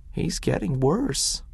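lightly voiced kind doctor; Doc Smith, grave. A narrator's reading. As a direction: break the bad news kindly. Doc Smith breaks bad news.

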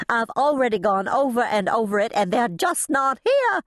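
high, distressed protest; agitated, climbing to a high cry; frantic insistence. A narrator's reading. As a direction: insist in mounting panic. Upset character insists they are gone.

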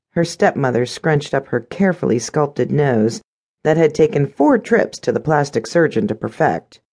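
plain reading voice

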